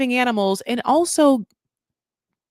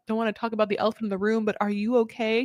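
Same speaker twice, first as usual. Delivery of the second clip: rushed awkward question; 4 dB quieter, faster than usual. Retold or imitated. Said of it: "He's like".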